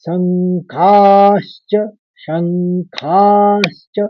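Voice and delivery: शंखाश्च drawn out slowly, stress on श and ख; stressed shankhashcha. Shown as a demonstration. Teacher demonstrating शंखाश्च syllable by syllable. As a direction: say शंखाश्च slowly, hitting श then ख. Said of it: "दूसरे का आघात के लिए".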